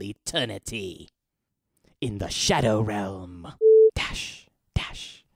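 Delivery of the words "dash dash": whispered. Real, not acted.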